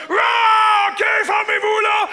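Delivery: high, loud giving-up cry; mock exasperation. Modern man giving up, loud exasperation. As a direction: throw up your hands. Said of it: "il a fait comme".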